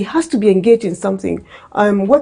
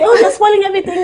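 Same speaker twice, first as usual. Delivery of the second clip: scolding parent; 11 semitones higher, much faster than usual. Retold or imitated. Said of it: "And then you say".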